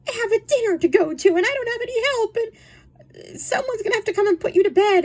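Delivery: high, put-upon complaint; mock fretful mother. Mother telling children she has no help, mock fretful. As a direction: imitate a helpless mother fussing in a high voice.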